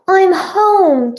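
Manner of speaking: loud, high call into the house. A narrator's reading. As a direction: call out to announce yourself. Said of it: "Joe called".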